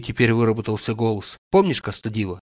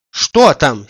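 impatient; 8 dB louder than usual. A narrator's reading. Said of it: "с нетерпением спросил он".

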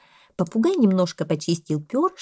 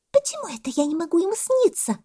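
very high, offended monkey; monkey butting in; 12 semitones higher than usual. A narrator's reading. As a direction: butt in as the little monkey. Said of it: "вмешалась мартышка". (мартышка вмешивается, очень высоко)

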